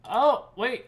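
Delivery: usual speaking voice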